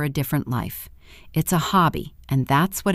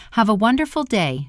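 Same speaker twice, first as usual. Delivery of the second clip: insincere politeness; 6 semitones higher than usual. Acted. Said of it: "without meaning it in the slightest".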